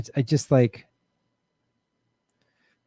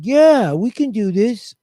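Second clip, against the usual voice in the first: mock optimism; 7 semitones higher, 4 dB louder, slower than usual. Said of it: "So you think".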